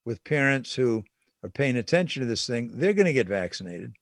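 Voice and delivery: ordinary talk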